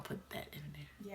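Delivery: quick whisper to herself; unvoiced aside. Real, not acted. Aside about editing the video.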